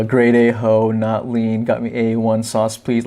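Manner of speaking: ordinary talk, nothing marked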